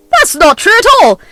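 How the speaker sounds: high, loud, outraged protest; shrill-free but high and loud, deny it hotly; indignant denial. Acted. Mother-in-law protesting to the party guests, high and indignant. Pauline denies the accusation.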